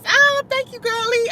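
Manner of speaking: high, bright squeal of thanks; thrilled thank-you to a viewer; delighted thanks. Real, not acted. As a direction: gush over the compliment. Thanks for big compliment.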